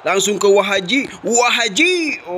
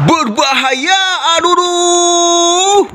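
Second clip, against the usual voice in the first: dramatic wail; 9 semitones higher, slower than usual. Real, not acted. Dramatic near miss wail.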